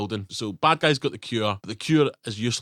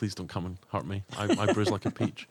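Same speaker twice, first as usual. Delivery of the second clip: much softer, mock cowering plea; mock timid plea; 4 semitones lower, 7 dB quieter than usual. Podcaster backs down from Australia, softer mock begging. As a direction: shrink back and beg softly, hammed up. Mock pleading after fight offer.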